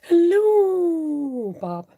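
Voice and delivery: high, loud hello sliding slowly down; drawn-out greeting. Pretend. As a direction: sing out a long, swooping hello. Playful drawn-out greeting of viewer.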